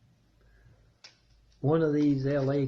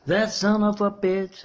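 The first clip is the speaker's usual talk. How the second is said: mild mock yell, higher pitched; retold yelling; 6 semitones higher, 4 dB louder than usual. Carla flies off the handle at the girls, mock yelling. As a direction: imitate an angry outburst, half-shouted. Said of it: "yelling, screaming".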